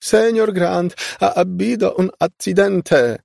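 high, loud cry of alarm. A narrator's reading. Maid reporting an accident to Senior Grant, crying out. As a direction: shout the news in a high, urgent voice. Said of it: "krzyknęła".

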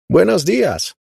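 high, loud cheerful greeting; calling out. A narrator's reading. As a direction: call it down to the patio. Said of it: "zawołała".

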